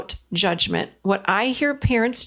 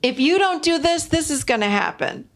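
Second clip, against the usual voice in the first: higher, lecturing parent threat; scolding parent; 7 semitones higher than usual. Retold or imitated. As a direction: warn the kid like a worried parent, pitch dropping at the end. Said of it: "the parent would jump right in there and go".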